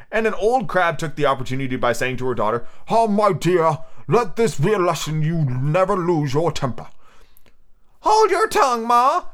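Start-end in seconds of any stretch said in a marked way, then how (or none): 2.9-6.9 slow, put-on old crab voice, pitched up; old mother crab. A narrator's reading. An old crab lectures her daughter, slow motherly put-on voice. 8.0-9.3 very high-pitched snappish youngster. A narrator's reading. Young crab answers its mother, snappish and high. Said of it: "said the young crab a little snappishly".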